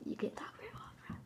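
hushed aside to camera; whispering. Real, not acted. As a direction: whisper it behind his back.

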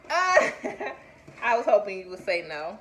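slow, loud words through laughter; laughing. Real, not acted. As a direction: laugh while you say it. Laughing at baby's answer.

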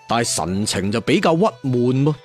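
plain reading voice

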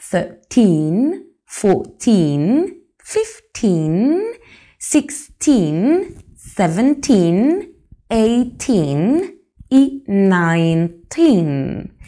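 put-on slow, every "teen" drawn out long and rising; teacher drilling the teen numbers slowly; long teen endings. Shown as a demonstration. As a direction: stretch the "teen" in "thirteen" to "nineteen". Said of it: "Es un teen así, larguito".

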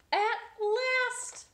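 high, loud relief; joyful triumph; triumphant. Acted. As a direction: cheer at last. Egg finally laid.

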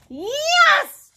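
loud, high monster cheer; triumphant cheer. A narrator's reading. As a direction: cry it out in victory. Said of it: "cheered Leonardo".

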